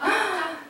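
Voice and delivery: sharp gasp of disbelief; shocked gasp. Acted. Newly told of a fatal illness, shocked gasp. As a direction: gasp as if hit by bad news.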